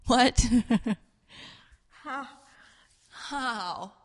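stunned questions with a laugh; incredulous survivor. Retold or imitated. Survivors hear the call home, incredulous. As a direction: blurt it, then fade to a hushed echo. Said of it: "sitting there going".

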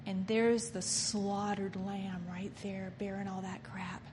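hushed disgusted whisper. Real, not acted. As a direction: breathe it out, no voice. Aside about the slaughtered lamb.

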